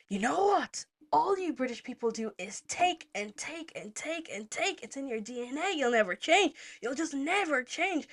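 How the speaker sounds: high, indignant tirade, drawn out; angry rant. Retold or imitated. Traveller ranting at British people, indignant. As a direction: hammer out a mock-furious accusation. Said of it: "So then I'm getting angrier and angrier".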